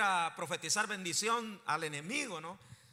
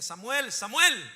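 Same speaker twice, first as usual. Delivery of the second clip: loud, raised call of a name; 3 semitones higher, 10 dB louder, slower than usual. Acted. God calls the boy Samuel at night, calling out loudly. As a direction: call as if to wake someone in the night. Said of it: "alguien le llamaba y le decía".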